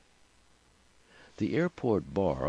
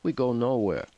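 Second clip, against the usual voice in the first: higher-pitched defiant protest; firm, raised-pitch objection; rising voice; 6 semitones higher, 4 dB louder than usual. A narrator's reading. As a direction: lift the voice to refuse. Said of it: "her voice rising".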